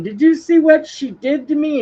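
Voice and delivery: high, loud, whiny wail; crying brother. Retold or imitated. Crying brother complaining to the uncle, high whiny wail. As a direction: wail it like a tattling kid. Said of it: "he's crying".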